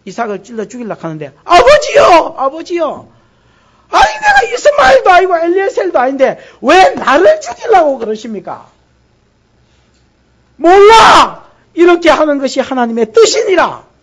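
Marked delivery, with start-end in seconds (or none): 1.4-8.7 loud, high, pleading cries; desperate cry. Acted. Isaac pleading with Abraham about to kill him, desperate cry. 10.6-13.9 loud, high shouted answer; anguished shout. Acted. Abraham shouting back at his pleading son, anguished.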